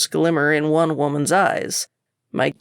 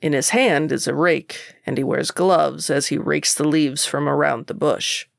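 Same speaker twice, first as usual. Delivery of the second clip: plain reading voice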